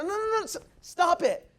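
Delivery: imitating the recruiter interrupting; recruiter cutting in. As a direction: recruiter stops him mid-sentence, high and quick. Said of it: "I said".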